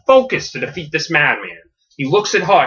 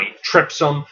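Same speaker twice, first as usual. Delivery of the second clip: ordinary delivery, nothing marked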